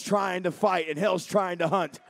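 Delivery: roaring, high preacher's shout; full-force preaching. Acted. Preacher shouting at the congregation, full force. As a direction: thunder it at the congregation.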